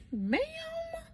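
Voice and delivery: very high-pitched squeak; squeaked, affronted retort; indignant disbelief. Retold or imitated. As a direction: question it in mock offense, voice way up. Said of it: "I wanted to get mad".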